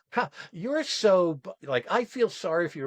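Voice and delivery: talking down with fake sympathy; mock pity. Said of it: "you started to make it that".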